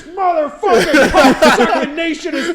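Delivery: high, slow mock bellow; ranting dad. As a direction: rant loudly enough for the next room. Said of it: "eating in silence as we can hear him".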